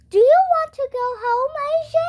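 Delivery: a cheery school nurse asking; lightly voiced friendly nurse; Missus Cooney voice. A narrator's reading.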